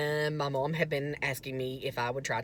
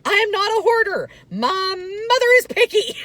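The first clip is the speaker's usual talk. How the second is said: mock proclamation, much higher and louder; 12 dB louder than usual. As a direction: announce it to the universe, hammed-up. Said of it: "proclaim loudly to the universe".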